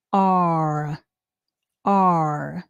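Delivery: drawn-out open ah for R; teacher modelling the ah in are; ah sound. Shown as a demonstration. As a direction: stretch the ah vowel. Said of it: "ah sound in this word".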